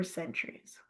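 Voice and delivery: breathy dreamy whisper on the last word; a dreamy hush on centuries. A narrator's reading. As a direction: let the word fade into a whisper. Dreamy trailing end of sentence.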